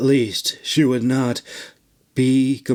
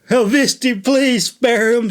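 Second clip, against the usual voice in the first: pleading; 10 semitones higher, 3 dB louder than usual. Acted. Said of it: "she pleaded".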